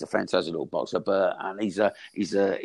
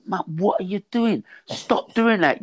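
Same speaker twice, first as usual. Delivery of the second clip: much higher, pitched-up scolding of mum; mock exasperated telling-off; 11 semitones higher than usual. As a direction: replay how you scolded mum, hammed up. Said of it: "I said".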